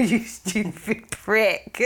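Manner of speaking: slow scornful insult through a laugh; mock insult. Wife scolding partner over a dream, mock scorn. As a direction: drag out the insult, half laughing.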